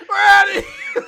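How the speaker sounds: shouted through laughter, high; laughing shout. Retold or imitated. Mocking the hero as he flees, laughing shout. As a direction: yell it while cracking up.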